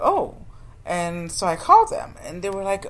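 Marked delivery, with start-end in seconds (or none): 0.0-0.4 sudden high surprised "oh". Retold or imitated. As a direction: pitch jumps up in a gasp of discovery. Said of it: "and I was like".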